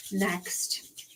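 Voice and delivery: slow hushed whisper; whispered question to Spirit. Acted. The reader asking Spirit about G, slow whisper. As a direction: breathe the question softly, slowly.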